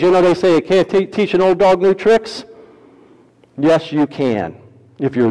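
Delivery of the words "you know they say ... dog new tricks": usual speaking voice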